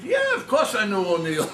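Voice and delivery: laughing through the quote, loud and high; real laughter breaking into a loud, high retold line. Real, not acted. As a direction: crack up while delivering the line. Retelling father's punchline, cracking up.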